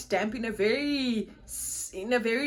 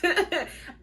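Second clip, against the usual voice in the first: a short, high-pitched laugh; 7 semitones higher than usual. Real, not acted. Laughing to viewers about maternity bag videos.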